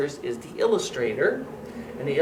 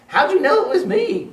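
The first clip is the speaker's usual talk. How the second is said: high, loud mock groan; groaning turkey; 14 semitones higher, 5 dB louder than usual. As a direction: whine like a caught turkey. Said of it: "groaned Turkey".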